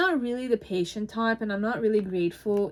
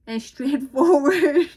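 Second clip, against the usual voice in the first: laughing; 5 semitones higher, 8 dB louder, slower than usual. Real, not acted. Laughs while playing the card.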